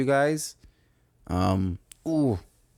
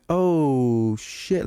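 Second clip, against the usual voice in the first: startled oh shit; 5 semitones higher than usual. Retold or imitated. Friend's startled line, tiptoeing away.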